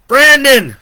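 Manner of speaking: very loud high holler. A narrator's reading. Joe hollers to Brandon while fighting, very loud. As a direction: roar his name over the fight. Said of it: "he hollered".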